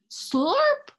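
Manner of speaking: high, loud, delighted slurp; excited slurp. Acted. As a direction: burst out with the slurp. Dog licks Billy, story climax.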